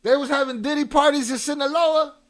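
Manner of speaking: loud, high-pitched shout of disbelief; shocked outburst. Real, not acted. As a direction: yell it out in astonishment. Shocked reaction to the played news.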